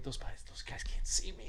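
whispered through a laugh; sneaking away from the buyers, laughing whisper; sneaky whisper. Retold or imitated. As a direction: hiss it like you're sneaking off. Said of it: "I'm like".

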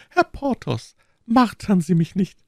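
very high, plaintive woman's voice; anguished high character plea; pleading wife. A narrator's reading. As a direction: beg him to stop tormenting you. Wife begs him to stop.

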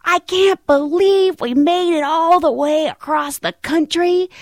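loud, high, drawn-out mock outburst; loud disbelief. Passenger four rows back hears tow-in announcement, loud disbelief. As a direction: imitate an appalled passenger calling out over the cabin.

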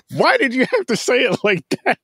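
high-pitched, laughing protest. Real, not acted. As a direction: ask it through real laughter. Cracking up at co-host's instruction.

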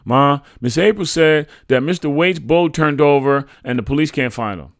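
put-on high, loud boy's voice; child reporting news. A narrator's reading. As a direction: pass on news to mother, pitched high. Boy relays news to mother.

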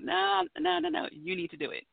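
a hint of a firm mother, starting high then dropping soft; mother gently insisting, no arguing; nice but firm mother. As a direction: insist kindly, no, no, no. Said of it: "being nice about it, firm".

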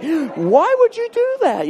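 high, slow incredulous question; mock bewildered bystander. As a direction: stretch it out like a baffled shopper. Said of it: "it's like".

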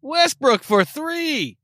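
slow, high, stretched sportscaster call; drawn-out announcer call. Pretend. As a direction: stretch it out like a big shot.

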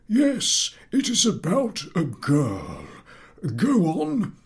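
put-on high, careful old teacher correcting; pedantic professor. A narrator's reading. Professor correcting a pupil's word choice, high and precise. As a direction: correct him kindly but firmly.

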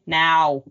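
imitating a cat's "Now", the meow starting on N; "Now" as a low meow with an N up front. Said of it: "He gets an N sound in there".

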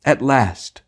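high hollow theatrical cry; a seedy old-school actor's exclamation. Acted. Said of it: "exclaimed in a hollow voice".

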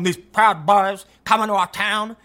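slow, grave put-on voice; mock-outraged warning; mocking Sean King. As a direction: imitate him as overly solemn. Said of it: "Sean King is going".

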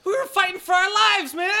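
high, loud protest; agitated denial. Acted. Trapped traveller denying druid's accusation, agitated. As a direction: deny it urgently. Accused character defends the group.

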